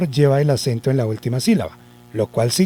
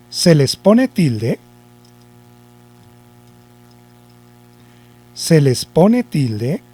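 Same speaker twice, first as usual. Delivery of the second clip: slow dictation with long pauses; dictado lento; slower than usual. Shown as a demonstration. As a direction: dictate it chunk by chunk, slowly. Teacher dictating the rule slowly. (dictando despacio, por pedazos)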